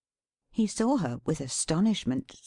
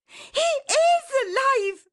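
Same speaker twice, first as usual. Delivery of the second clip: very high, loud, drawn-out cry; ecstatic shriek; 6 dB louder, slower than usual. A narrator's reading. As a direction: shriek the joyful news slowly. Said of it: "shrieked Dot".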